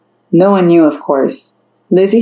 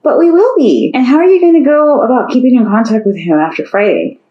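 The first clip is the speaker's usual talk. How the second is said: put-on teen girl voice; 7 semitones higher than usual. Lizzie voiced, higher.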